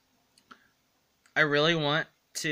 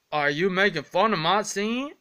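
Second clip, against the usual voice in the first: ordinary delivery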